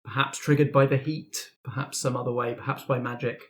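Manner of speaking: normal talking voice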